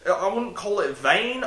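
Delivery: ordinary talk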